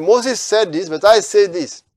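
high and loud, punching I; Jesus setting his word above Moses; authoritative declaration. Retold or imitated. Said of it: "He said".

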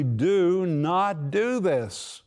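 very slow, each word of "Do not do this" stressed; categorical, emphatic. Retold or imitated. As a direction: lay down "Do not do this" as a firm prohibition. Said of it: "categorically, emphatically".

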